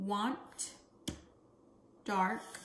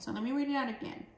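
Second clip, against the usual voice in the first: ordinary talk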